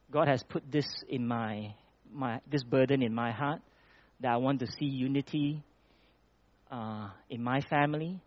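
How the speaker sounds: normal talking voice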